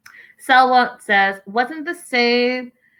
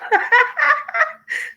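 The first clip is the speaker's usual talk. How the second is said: burst of laughter. Real, not acted. Host cracking up at a viewer's joke, laughing high. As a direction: crack up at the pina colada comment.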